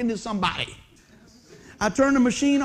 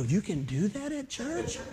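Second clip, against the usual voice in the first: hushed amazement; 9 dB quieter, slower than usual. Retold or imitated. Newcomers' amazed reaction.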